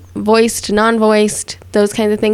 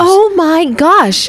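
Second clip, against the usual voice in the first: shocked; 7 semitones higher, much slower than usual. Real, not acted. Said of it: "shocked at news".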